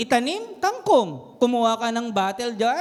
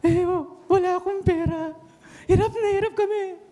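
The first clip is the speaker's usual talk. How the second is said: mock tearful complaint; 7 semitones higher than usual. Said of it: "Umiiyak siya".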